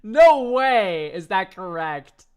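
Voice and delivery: half-laughing cry of disbelief, drawn out; incredulous outburst. Retold or imitated. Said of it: "I'm like".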